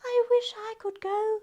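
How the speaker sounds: high, soft Cinderella voice; wistful and small, a voiced near-whisper; whispered wish. Cinderella wishes she could go to the ball, high and hushed. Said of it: "she whispered".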